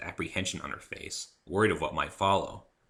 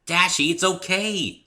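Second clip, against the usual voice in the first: reassuring; 11 semitones higher, 8 dB louder, slower than usual. Acted. Pinkie calms Rainbow Dash.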